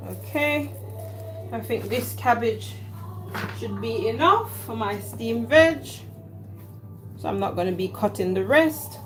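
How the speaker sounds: ordinary talk, nothing marked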